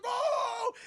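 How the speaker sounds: loud, very high yelp; hammed-up startled cry; startled shout. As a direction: jump and yell it. Startled cry in retold film.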